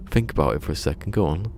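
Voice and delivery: quick, nudging inner voice; brisk inner voice. Retold or imitated. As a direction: hurry yourself to think it through. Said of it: "and I was going".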